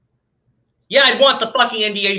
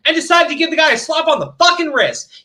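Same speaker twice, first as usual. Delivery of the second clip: raised, indignant rant; angry; 7 semitones higher than usual. Real, not acted. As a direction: bite out the injustice.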